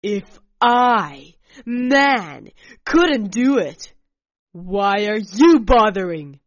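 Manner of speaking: loud furious outburst; angry shout. Acted. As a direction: yell it in a rage. Alec's angry outburst continues.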